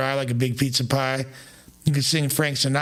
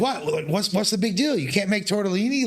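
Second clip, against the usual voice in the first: higher-pitched, mildly indignant questioning; retold incredulity; 6 semitones higher than usual. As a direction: re-enact a puzzled protest. Acts out own puzzled reply to grandma.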